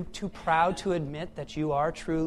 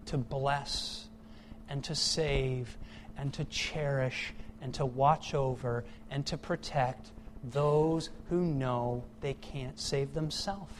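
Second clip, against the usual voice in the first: ordinary talk, nothing marked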